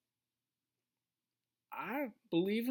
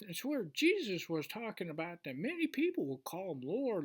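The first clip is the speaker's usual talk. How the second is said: usual speaking voice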